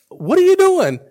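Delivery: high, puzzled husband asking; baffled question. Retold or imitated. Husband puzzled by his drugged wife, high baffled ask. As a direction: ask it like you can't believe your eyes.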